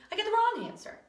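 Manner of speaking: high, loud burst of frustration; exasperated protest. Acted. Baffled pupil complains to his teacher about counting, exasperated. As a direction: protest it with a jump up in pitch.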